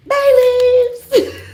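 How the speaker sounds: high, loud laughter through the words; laughing. Real, not acted. Laughing at her own joke to viewers, laughing loudly. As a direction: crack up mid-sentence.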